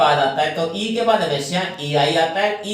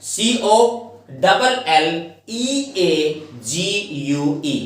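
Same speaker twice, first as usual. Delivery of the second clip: slow spelling; much slower than usual. Shown as a demonstration. Teacher spelling a word for students, slowly. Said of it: "spelling out letters".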